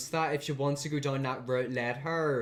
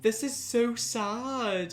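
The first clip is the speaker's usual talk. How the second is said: slow, higher, wobbly voice on the edge of tears; tearing up; 7 semitones higher, much slower than usual. Real, not acted. Viewer choked up at the dad's apology, slow and wobbly. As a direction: let the words drag out as the tears come. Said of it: "why is this starting to make me tear up".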